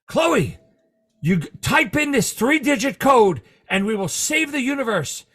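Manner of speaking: commanding TV agent; Kiefer Sutherland impression. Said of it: "Kiefer Sutherland calls her".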